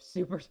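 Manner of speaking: high squeaky burst of laughter; real laugh, voice cracking upward. Real, not acted. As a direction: crack up till the voice breaks. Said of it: "sorry about my voice crack".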